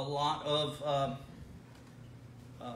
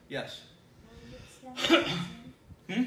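ordinary delivery

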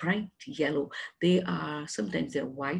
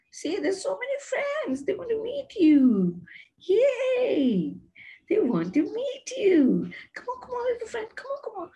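very high sing-out to a shy little pet; playful coaxing; 14 semitones higher, 5 dB louder than usual. Pretend. Teacher coaxing a shy hamster to meet friends, high playful coaxing. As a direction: coax a timid little creature out, pitched way up.

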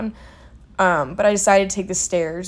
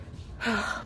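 long winded exhale; tired sigh; 2 semitones higher, 9 dB quieter than usual. Real, not acted. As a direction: let out a spent sigh. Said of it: "I'm out of breath".

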